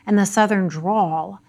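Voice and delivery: ordinary talk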